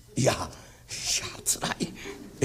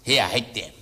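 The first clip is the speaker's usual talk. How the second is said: low, unhurried rakugo narration; storyteller narration; 7 semitones lower than usual. A narrator's reading. Teller describing the contrary master to the audience, low and calm.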